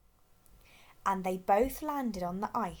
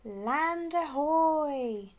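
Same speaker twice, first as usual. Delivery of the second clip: long, drawn-out call, higher and louder; shouted; 6 semitones higher, 4 dB louder, much slower than usual. A narrator's reading. Rossi spotting land, a long shout. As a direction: call it out across the sea. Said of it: "shouted Rossi".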